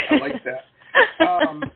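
drawn-out chuckling delight; laughing. Real, not acted. Host laughing with delight at the guest's phrase.

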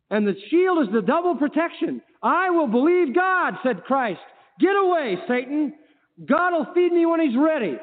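high, loud sermon declamation; full-force preaching. Acted. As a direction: ring out each phrase with defiant conviction.

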